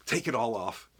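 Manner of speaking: ordinary talk, nothing marked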